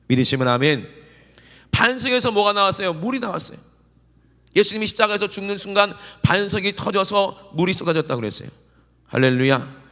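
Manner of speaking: loud, rising revival preaching; fervent preaching. Acted. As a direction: drive the congregation to response. Preaching at full force. (힘차게 외치는 부흥 설교)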